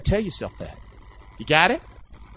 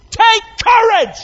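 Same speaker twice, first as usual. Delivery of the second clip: "Take courage!" shouted much higher and louder; shouted exhortation; 8 dB louder, slower than usual. Shown as a demonstration. Preacher rousing the congregation to take courage, shouting. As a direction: cry it out to rouse the room.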